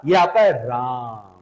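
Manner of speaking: राम with its आ drawn out long, pluta; prolonged Raam. Shown as a demonstration. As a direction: stretch the vowel in राम well past three beats. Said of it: "अब इसे मैं राम नहीं बोलूंगा". (राम का आ लंबा खींचकर)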